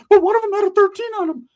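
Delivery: loud, very high squeaky protest; mock nervous cousin. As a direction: squeal the protest in a caricatured panic. Imitates nervous cousin Ricky protesting.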